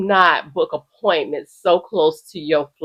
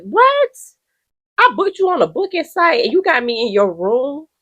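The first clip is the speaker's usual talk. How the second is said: outraged disbelief; 10 semitones higher than usual. Real, not acted. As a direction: ask it scandalized.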